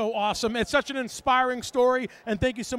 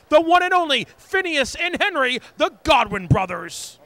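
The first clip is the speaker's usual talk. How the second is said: loud, booming wrestling announcer; big announcer build, stretched on the final name; ring announcer send-off; 8 dB louder than usual. Acted. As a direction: hype the tag team to the crowd.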